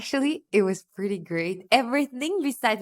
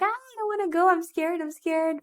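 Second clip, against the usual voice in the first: high, whiny imitation of nervous friends; a mock frightened plea from friends before the balloon ride; mock scared whine; 10 semitones higher than usual. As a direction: play the scared friends, hammed-up and pitched up.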